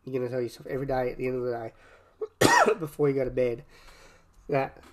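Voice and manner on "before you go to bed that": normal talking voice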